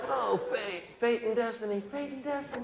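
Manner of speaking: mock ecstatic moan. Atheist's climax cry suggestion.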